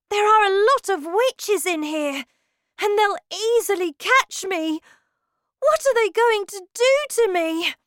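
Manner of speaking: put-on very high, loud frightened child voice; fretting in high-pitched alarm; panicked boy. A narrator's reading. Hidden boy fears the witches, high panic.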